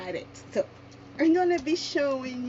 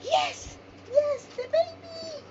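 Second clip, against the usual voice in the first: excited squeal. Retold or imitated. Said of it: "I said".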